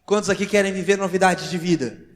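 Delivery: loud preacher's call to the crowd; rallying call. Real, not acted. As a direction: call out firmly to a whole congregation. Preacher rallying the congregation to respond.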